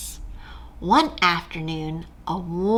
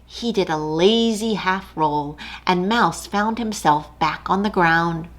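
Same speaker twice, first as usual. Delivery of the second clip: ordinary delivery, nothing marked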